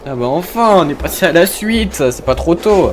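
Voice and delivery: loud, high-pitched "finally!"; high, loud, impatient outburst; exasperated relief. Real, not acted. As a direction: cry out in fed-up relief. Finally passes to next stage.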